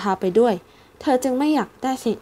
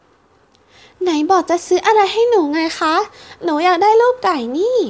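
put-on high, slow, louder child protest; child demanding the chick; insistent little girl; 9 semitones higher, 5 dB louder, slower than usual. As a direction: remind them of their promise, pouting.

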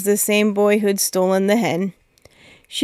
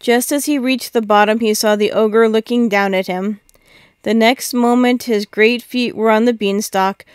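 ordinary delivery

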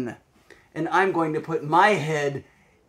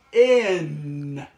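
"In" said clearly and a bit louder; clear spoken model; 3 dB louder than usual. Shown as a demonstration. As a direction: say "In" crisply with the voice. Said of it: "You can say it with your voice like this".

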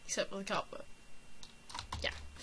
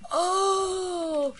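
much higher, louder hammed-up gasp and "Oh!"; gasping crowd amazed at the zombie killer; mock awed gasp; 10 semitones higher, 7 dB louder than usual. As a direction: play the impressed crowd, overdone. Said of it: "Everyone just goes".

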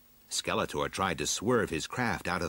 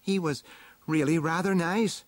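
high-pitched heroine voice; high character voice, reflecting; storybook heroine; 9 semitones higher than usual. A narrator's reading. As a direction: voice the heroine's closing remark up high. Heroine's closing line in the story.

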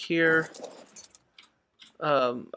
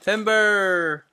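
calling out; 5 semitones higher, 3 dB louder than usual. Real, not acted. Warning friend of falling tree.

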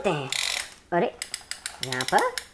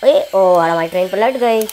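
normal talking voice

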